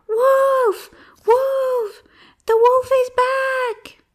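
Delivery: very high, drawn-out alarm cry; loud shout. Acted. Shepherd boy fooling villagers a second time, loud shout. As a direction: yell the false alarm at full voice. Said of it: "shouted even louder than before".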